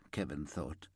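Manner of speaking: usual speaking voice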